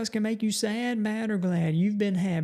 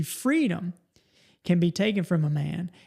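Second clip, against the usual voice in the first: ordinary delivery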